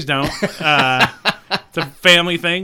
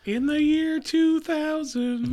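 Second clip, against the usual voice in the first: mock dramatic; 11 semitones higher, 5 dB quieter, slower than usual. Parody of the "year 2000" sketch.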